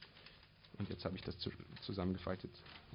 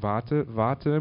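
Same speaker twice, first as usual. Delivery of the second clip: plain reading voice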